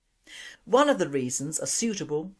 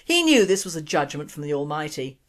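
ordinary delivery

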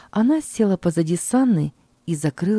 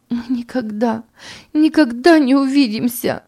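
put-on a mother pouring out grief to her baby; mother bids her baby farewell in anguish; despairing farewell; 6 semitones higher than usual. A narrator's reading. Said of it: "воскликнула она".

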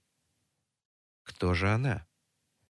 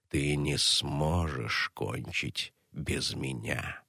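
low, slow, mocking male villain voice; menacing low villain; 5 semitones lower, slower than usual. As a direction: drag each word out with a gloating sneer. Archon's taunting voice in the narration.

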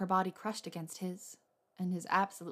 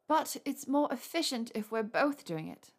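higher and slow, coaxing sweetly; cajoling; 5 semitones higher than usual. A narrator's reading. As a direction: wheedle him into letting her help. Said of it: "she said in a cajoling voice".

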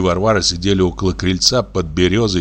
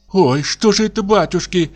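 put-on much higher, frightened old woman; Varvara in horror; 10 semitones higher than usual. A narrator's reading. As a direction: gasp it out aghast. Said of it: "бормотала она в ужасе". (старушка в ужасе, намного выше)

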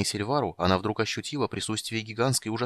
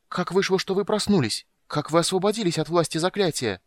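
high, frightened gasp; startled girl caught, afraid; girl startled by intruders, high frightened voice; gasping in fright; 10 semitones higher than usual. A narrator's reading. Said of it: "ахнула она".

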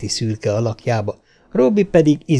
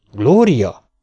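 sharp, louder call of the name; warning; 5 dB louder than usual. A narrator's reading. Mother interrupts her pleading daughter, sternly warning. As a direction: cut the child off with her name.

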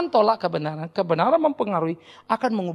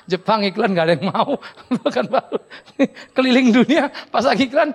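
words bubbling with laughter; laughing; 5 dB louder than usual. Real, not acted. Preacher teasing singles about advertising themselves, laughing. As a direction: joke while laughing.

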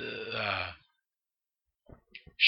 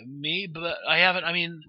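higher-pitched, mildly flustered acting; nervous, a little frightened; 8 semitones higher than usual. Comedian approached by police officers, nervous and a little frightened. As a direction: play a nervous man stammering out a protest. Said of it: "he's a little nervous, a little frightened".

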